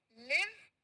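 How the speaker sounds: लिव with short i, rising; short-i live. Shown as a demonstration. Teacher leading a pronunciation drill, लिव with short i. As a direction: model लिव with a brief vowel. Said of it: "मेरे साथ में इसका pronounce".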